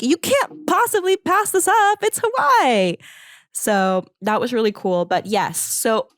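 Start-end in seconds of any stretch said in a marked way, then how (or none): 0.0-3.0 very high-pitched, excited imitation of supportive coworkers; encouraging teammates. Teammates urging her to take the trip, excited and encouraging. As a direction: squeal it as gushing teammates would. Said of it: "encouraging people who were like".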